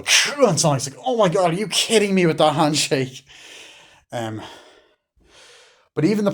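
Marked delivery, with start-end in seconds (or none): 0.0-0.5 loud, high whooshing noise; imitated crunch noise. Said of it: "and Knuckles go". 1.0-3.2 high, loud imitated protest; mock outraged disbelief. Sonic reacting to crushing handshake.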